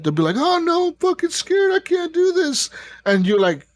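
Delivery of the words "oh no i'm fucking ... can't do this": high-pitched mock panic; scared young soldier. Younger squad soldier panicking to the player, mock scared. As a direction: imitate a frightened kid, voice pitched way up. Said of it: "they'll be all scared and shit".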